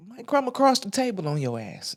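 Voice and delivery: soft whispered warning; whispered threat. Real, not acted. Threatening the mother, in a whisper. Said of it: "whispered threat".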